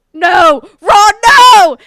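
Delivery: loud, high movie-quote yell; shouted, hammed-up plea; mock dramatic shout. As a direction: bellow it like an over-the-top film character. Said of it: "Ethan and I would be like".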